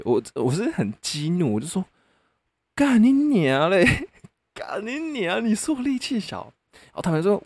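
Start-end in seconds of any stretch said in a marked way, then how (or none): 2.8-6.5 furious retort. Retold or imitated. Man fires back at girlfriend who called him weak, enraged curse. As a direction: explode at her like an insulted man. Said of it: "我真的会爆气".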